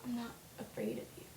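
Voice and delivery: soft, hushed and dead serious; imitating a serious, quiet heroine; suddenly serious. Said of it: "she gets all serious suddenly".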